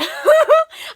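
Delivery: a high, loud burst of laughter. Real, not acted. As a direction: crack up at your own joke. Bursts out laughing at own remark.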